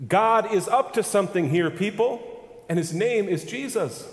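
high, loud preacher's cry; preaching at full force. Acted. Preacher lifts his voice to the congregation, rising proclamation.

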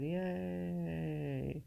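long drawn-out, gliding yay; half-hearted cheer. Pretend. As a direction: draw out a mock celebratory yay. Celebrating hundredth episode in a low mood.

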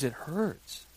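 put-on tiny, soft hurt-child voice; imitated whimper. Five-year-old telling dad she is hurt, imitated whimper. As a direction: whimper it very quietly like a little kid. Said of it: "she starts to whimper".

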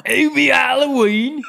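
high, slow put-on English accent; mock-posh English take on a film title. Saying Hubie Halloween in a mock English accent. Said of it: "how do you think it sounds like in an English accent".

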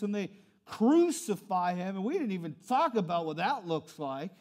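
ordinary delivery

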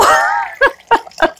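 high burst of laughter; real high laugh; high giggle. Real, not acted. Laughing at own whining.

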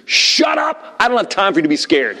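high-pitched outburst at a scared son; comic re-enacted dad scream; retold scream. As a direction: snap it out high, then press on. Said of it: "I just screamed".

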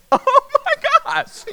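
very high, loud, drawn-out shriek; acted panic. Seeing a man with a knife burst in, shrieked acted panic. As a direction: cry out slowly in mock terror.

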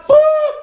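very high, loud playful boop. Acted. Preacher tossing the hot potato to God, a high boop. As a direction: pop it off like tossing a ball.

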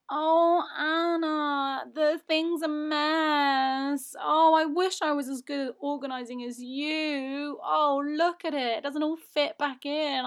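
high, slow, whiny fake helplessness; mock helpless mum. Daughter mimics her mum feigning helplessness, high and whiny. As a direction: overplay the poor helpless mother fishing for help. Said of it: "feigns rubbishness".

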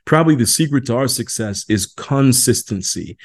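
put-on a touch of British accent on the quote. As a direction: quote the rock singer in a light British accent. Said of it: "in his British accent".

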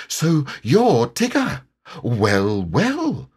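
high piglet voice feigning ease; high-pitched, would-be casual piglet; careless. A narrator's reading. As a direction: act offhand as a braver small animal, slowly. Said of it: "in a careless sort of voice".

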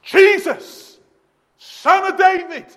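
high, full-force shout; desperate cry. Retold or imitated. Preacher as blind Bartimaeus over the crowd, desperate shouted cry. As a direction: shout it out to get Christ's attention. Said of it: "he cries out".

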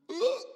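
sudden high yelped ugh; frustrated exclamation. Retold or imitated. Pastor imitates his own near-swear to the congregation, high yelped ugh. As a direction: let a sharp, high ugh burst out. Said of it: "words just kind of come rising up".

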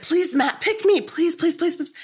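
high-pitched pleading fan; imitating desperate commenters, rapid high pleas; mock begging. Voicing fans begging in live comments.

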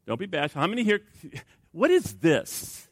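ordinary delivery

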